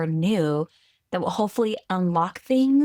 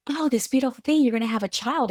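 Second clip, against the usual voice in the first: high, bright-eyed gushing, louder; gushing excitement; 6 semitones higher, 3 dB louder, faster than usual. Retold or imitated. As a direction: gush over the happy news. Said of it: "it was never this like".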